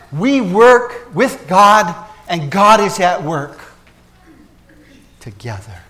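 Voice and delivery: loud, slow, deliberate declaration; slow emphatic. Real, not acted. Pastor stating the paradox to his church, loud and slow. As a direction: weigh every word. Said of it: "slow emphatic summary".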